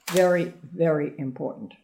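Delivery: ordinary delivery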